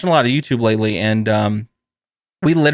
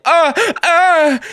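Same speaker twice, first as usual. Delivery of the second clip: high, strained little cries; hammed-up pain gasps; mock pained groans; 4 dB louder than usual. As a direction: imitate a patient's crack-induced yelp. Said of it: "And they're like".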